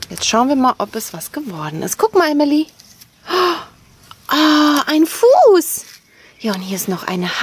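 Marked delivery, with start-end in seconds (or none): 2.0-5.8 high and loud, delighted; excited surprise. Real, not acted. Staunt mit Kind über Sandfuß. (begeistertes Staunen, hoch und laut)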